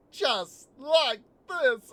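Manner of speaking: high, loud, slow villain voice; Judge Doom. Retold or imitated. Film fan re-doing the villain's taunt, slow high squeak. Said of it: "higher and squeakier".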